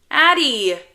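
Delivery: high, very loud yell; piercing little-brother call; child's shout. Acted. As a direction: holler the nickname as an annoyed kid.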